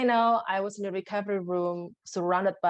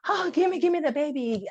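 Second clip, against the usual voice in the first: mock eager joy; 7 semitones higher, 4 dB louder than usual. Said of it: "There's no, like".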